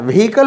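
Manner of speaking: व्हीकल squeezed into vhee-kal; व्हीकल as two syllables, VHEE-kal; mistaken pronunciation. Shown as a demonstration. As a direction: say व्हीकल without the middle uh. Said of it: "नहीं बोलना है".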